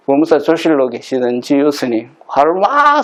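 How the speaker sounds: normal talking voice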